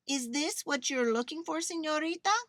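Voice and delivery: high, lilting call; manager calling. A narrator's reading. Manager calls to the girl searching, questioning. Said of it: "heard the manager call to her".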